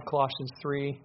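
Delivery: ordinary talk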